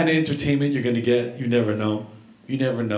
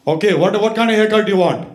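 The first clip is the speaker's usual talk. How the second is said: higher, louder, fast demand; impatient barber; 5 semitones higher, 5 dB louder, faster than usual. Retold or imitated. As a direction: fire the question at him. Barber demanding haircut choice.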